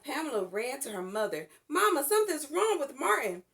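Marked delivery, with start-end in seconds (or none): 1.7-3.4 alarmed. A narrator's reading. Friend choking, child alarmed.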